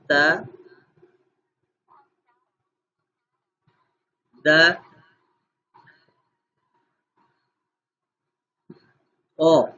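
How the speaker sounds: Arabic letter sounds "تا" "دا" "او" spoken clearly one by one; each letter sound said slowly for repetition; model sounds. Shown as a demonstration. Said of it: "سبھی لوگ ذرا اس کو دہرائیں".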